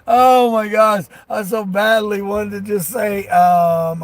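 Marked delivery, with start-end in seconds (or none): 0.1-1.1 slow, louder drawn-out disbelief; exasperated disbelief. Retold or imitated. Hearing the friend's excuse about the tire, slow exasperated disbelief. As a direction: stretch it out in mock exasperation. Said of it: "I was like".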